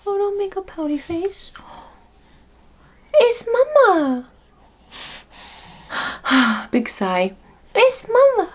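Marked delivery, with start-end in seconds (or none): 0.1-1.5 put-on whispered baby voice. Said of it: "He's like". 3.1-4.3 very high, slow coo; high sing-song mama voice. Real, not acted. Mom coos to baby. 7.8-8.5 very high, slow coo; high sing-song mama voice. Real, not acted. Mom coos to baby.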